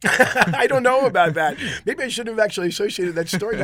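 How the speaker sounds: real laugh that spills into the words, slowed down; high, loud laughing protest. Real, not acted. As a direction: laugh through it, caught out. Laughs at his own acid story.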